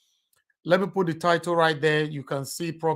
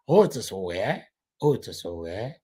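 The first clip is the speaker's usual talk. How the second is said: put-on Canadian accent; 5 semitones lower than usual. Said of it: "that Canadian accent go dey there".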